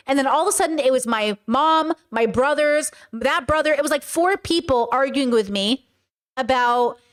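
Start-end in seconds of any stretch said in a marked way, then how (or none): none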